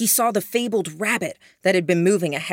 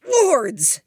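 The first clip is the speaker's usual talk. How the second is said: sudden high cry of pain; cussing; 9 semitones higher, 5 dB louder than usual. Acted. Duncan hit on the head, sudden high loud cuss. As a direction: yelp it out as something hits your head. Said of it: "Duncan cussed".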